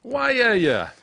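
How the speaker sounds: can't believe what he's hearing; incredulous. Real, not acted. Reacting to the memorial story with shocked, high disbelief.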